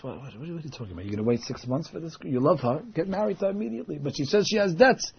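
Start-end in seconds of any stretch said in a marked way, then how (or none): 0.0-4.0 fast, softer rapid scolding; matchmaker scolding. Retold or imitated. The matchmaker scolding the groom, fast and softer. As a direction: rush the scolding.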